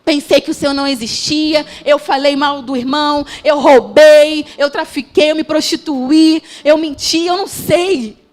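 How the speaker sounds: loud, climbing, fervent confession of sins; impassioned preaching. Acted. Preacher crying out to the congregation, loud and fervent. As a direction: preach it with rising fire.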